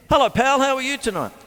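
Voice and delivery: talking to the dog. Real, not acted. Greeting the dog on set.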